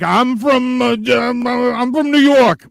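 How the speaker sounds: high, slow, halting mock voice; mock stammering New Yorker. Mocking Bodie's claim to be from New York, halting. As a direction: stumble over the claim like a caught liar. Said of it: "he ca-, says".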